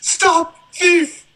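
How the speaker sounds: very high, short mock cries for help; mock movie cry. Podcaster mimics Coming to America for his laughing co-host, high mock cry. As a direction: imitate a high-pitched comic shout from a film.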